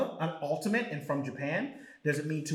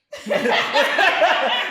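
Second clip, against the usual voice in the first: high burst of laughter; loud laugh; 8 semitones higher, 8 dB louder than usual. Real, not acted. Big laugh at joke.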